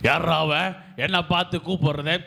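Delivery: gruff husband voice. Acted. Gruff husband answering call.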